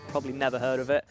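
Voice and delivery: usual speaking voice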